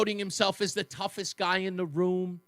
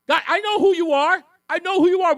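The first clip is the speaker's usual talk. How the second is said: much higher, louder accusing rant; 10 semitones higher, 9 dB louder than usual. Real, not acted. Host calling out William Tong, loud and accusing. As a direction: call him out so the whole room hears.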